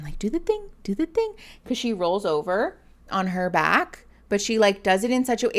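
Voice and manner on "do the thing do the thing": high, soft sing-song coax; coaxing pet talk. Retold or imitated. Owner urging her cat to do its trick, high coaxing voice. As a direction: coax the cat to roll over. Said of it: "Erin and I tell her all the time".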